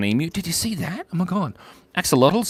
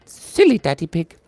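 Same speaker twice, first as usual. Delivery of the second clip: high, loud cartoon mother voice; Mama Pig; 6 semitones higher, 7 dB louder than usual. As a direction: tease in a put-on high voice. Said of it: "Do Mama Pig voice".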